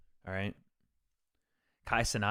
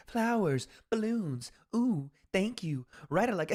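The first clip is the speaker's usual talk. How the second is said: much higher, sing-song fake streamer thank-you; mock NPC streamer; 10 semitones higher, slower than usual. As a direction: parody a robotic streamer thanking for gifts. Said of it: "I'll be that N P C streamer for you guys".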